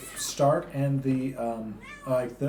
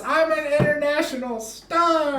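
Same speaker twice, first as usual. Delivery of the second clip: excited quote; 13 semitones higher, 5 dB louder than usual. Retold or imitated. Quoting Vern Troyer's line.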